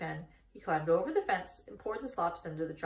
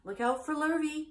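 higher, carrying call; calling cows; 9 semitones higher, 4 dB louder than usual. A narrator's reading. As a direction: call out a warning like a cow. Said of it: "called the cows".

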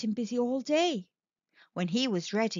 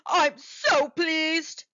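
pleased shark; 5 semitones higher, 4 dB louder, much slower than usual. Acted. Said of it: "he said".

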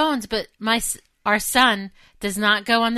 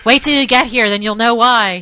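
raised voice, hollering into the phone; imitated yelling; 3 semitones higher, 6 dB louder than usual. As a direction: shout it over a noisy line. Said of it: "he started doing that whole yell thing".

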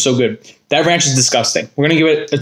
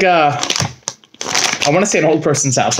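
ordinary talk, nothing marked

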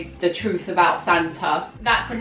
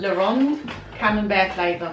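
attempted French accent on the cheese name. Real, not acted. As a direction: say it the French way, a touch showy. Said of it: "Trying to cultivate a French accent there".

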